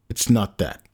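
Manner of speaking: ordinary delivery, nothing marked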